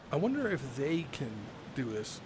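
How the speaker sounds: usual speaking voice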